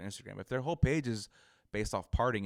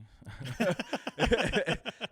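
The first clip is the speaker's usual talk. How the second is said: burst of laughter; 13 semitones higher, 9 dB louder than usual. Real, not acted. Laughs at taken username.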